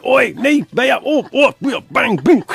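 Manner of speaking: high, mock yelps and thwacks; imitated smacking commotion. Ice cream man overhears children being smacked, imitated yelps. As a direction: act out the slaps and cries. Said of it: "the tuppers go in and".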